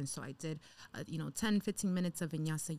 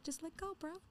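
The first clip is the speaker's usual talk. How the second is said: voice of the universe; 10 semitones higher, 10 dB quieter than usual. Retold or imitated. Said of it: "the universe is like".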